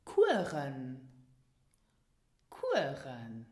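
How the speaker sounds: Kuren said slowly with a rolled uvular r; careful teacher model of Kuren, rolled r; model pronunciation. Shown as a demonstration. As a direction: Kuren spoken clearly for learners to repeat. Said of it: "Listen and repeat after me".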